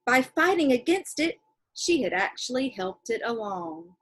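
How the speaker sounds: reading a letter from a novel to the class; steady read-aloud voice for kids. Acted. Teacher reading a letter in a novel to her class, read-aloud voice.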